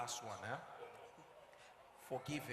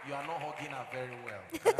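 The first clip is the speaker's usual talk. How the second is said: louder, playful jab at the husband; teasing call-out; 9 dB louder than usual. Real, not acted. Preacher teasing the husband in front of everyone, loud and playful. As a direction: tease him out loud.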